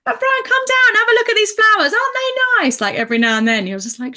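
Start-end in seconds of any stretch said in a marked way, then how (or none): 0.0-2.8 put-on much higher, chirpy old-lady voice; vocal great auntie. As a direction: chatter like an old aunt showing flowers. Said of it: "very vocal".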